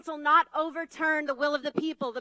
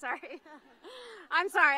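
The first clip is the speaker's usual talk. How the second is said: emotional laugh. Real, not acted. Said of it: "I'm emotional after reading that".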